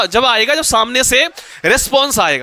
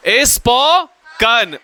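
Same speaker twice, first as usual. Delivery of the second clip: "Spoken" stretched slowly in two parts, spo, then kan; syllable by syllable; much slower than usual. Shown as a demonstration. Teacher dictating the verb form to class, syllable by syllable. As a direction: draw out "spoken", pausing before the last syllable.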